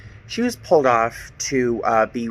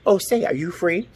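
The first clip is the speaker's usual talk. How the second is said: mock polite ask; 6 semitones higher than usual. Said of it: "It wasn't like I was asking".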